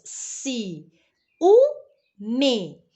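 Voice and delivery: Si, u, me as separate syllables with pauses; teacher showing the three syllables again. Shown as a demonstration. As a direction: tap out Si, u, me slowly. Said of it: "Por isso que deu três sílabas".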